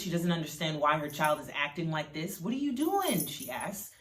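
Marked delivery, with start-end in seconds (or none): 2.4-3.3 higher, mildly distressed plea; a touch of tearful exasperation, pitched up; verge of tears. A narrator's reading. Mother not understanding her child, higher and mildly upset. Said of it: "on the verge of tears".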